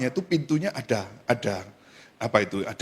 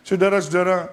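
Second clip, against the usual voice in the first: ordinary talk, nothing marked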